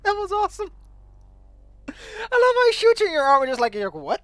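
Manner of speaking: laughing loudly while talking; cracking up at the game. Real, not acted.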